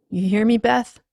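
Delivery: anxious calling. Acted. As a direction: call out for an answer. Calling to silent wife.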